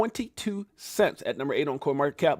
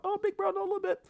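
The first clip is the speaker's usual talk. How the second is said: much higher, softer mock sister voice; imitating sister; 6 dB quieter, faster than usual. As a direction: tease in his sister's proud voice. Voicing his proud sister.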